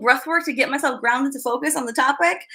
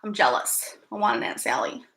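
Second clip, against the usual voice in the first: lower, whispered mock jealousy; whispered envy; 6 semitones lower, 7 dB quieter than usual. Real, not acted. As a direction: sigh it out like a playful secret.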